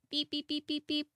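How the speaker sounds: high, even electronic beeping; imitated phone beeps. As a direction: beep softly on one high note.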